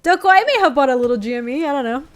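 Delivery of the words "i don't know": ordinary talk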